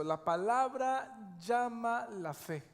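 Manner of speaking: very slow, each word spaced for note-taking; slow, deliberate dictation to the congregation. Shown as a demonstration. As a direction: spell it out slowly so they can write it down. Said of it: "escriba esto".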